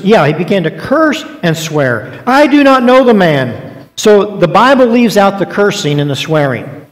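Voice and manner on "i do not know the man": higher, louder, slow angry denial. Acted. Peter cursing and swearing at the bystanders, angry and loud. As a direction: spit it out as a final angry refusal.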